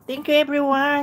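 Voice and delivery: high, loud thank-you; loud cheerful thanks. Real, not acted. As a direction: shout thanks to everyone.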